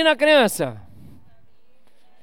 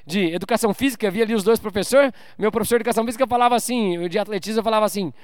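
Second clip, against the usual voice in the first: usual speaking voice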